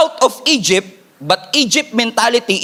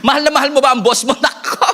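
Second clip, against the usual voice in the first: usual speaking voice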